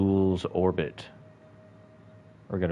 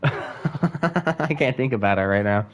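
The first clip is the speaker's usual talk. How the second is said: laughing; 4 semitones higher, slower than usual. Real, not acted. Laughing at the video.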